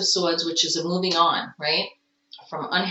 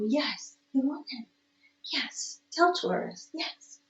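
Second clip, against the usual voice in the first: cooing pet talk; 5 semitones higher, 7 dB quieter than usual. Real, not acted. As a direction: coax the cat softly. Coaxing the cat mid-reading.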